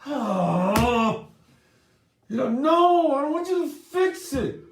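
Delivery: high, loud, mock-tearful wail; frustrated crying wife. Wife to husband, high tearful frustrated wail. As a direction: cry out in frustration at him. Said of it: "makes the woman more frustrated".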